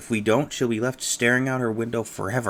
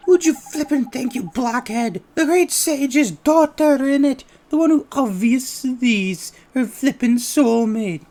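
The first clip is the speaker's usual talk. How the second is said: higher, loud, excitable little sidekick; high cheeky fairy voice; 14 semitones higher, 6 dB louder than usual. Acted.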